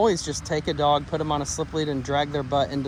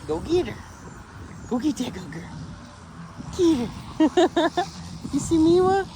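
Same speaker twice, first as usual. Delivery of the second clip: excited dog talk; 13 semitones higher than usual. Real, not acted. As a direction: call out to the dog in delight.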